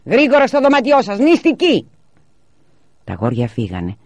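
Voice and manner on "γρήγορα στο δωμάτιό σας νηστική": very high, loud yell; full-force angry command; father's shouted order. A narrator's reading. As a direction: shout the children off to their room. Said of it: "η φωνή του μπαμπά τη σταμάτησε".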